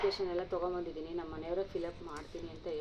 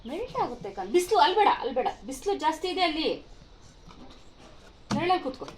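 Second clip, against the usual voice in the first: much higher, louder urging call; 9 semitones higher, 7 dB louder than usual. Real, not acted. Mother calling her son out of the sun to eat, much higher and louder.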